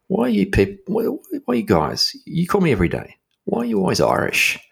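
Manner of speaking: faux-naive high-voiced query; mock innocent question. Teasing question to scammer.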